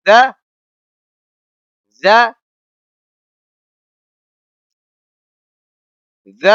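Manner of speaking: ز pushed out with a sharp jolt; jerked zu. Shown as a demonstration. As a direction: give ز a quick jerk. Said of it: "اور جھٹکا دینا ہے".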